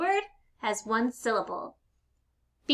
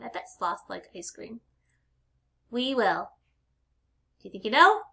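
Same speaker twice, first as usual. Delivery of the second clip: ordinary talk, nothing marked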